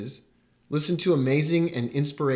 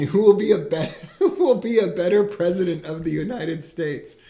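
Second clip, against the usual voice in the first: laughing through it; 3 semitones higher, 3 dB louder than usual. Real, not acted. Laughing through his own question.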